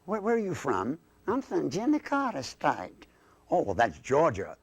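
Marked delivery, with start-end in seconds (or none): 1.3-3.1 high Southern puppet drawl; thick Southern accent, pitched up. Acted. Southern dummy says where home is, high Southern drawl. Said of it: "that accent".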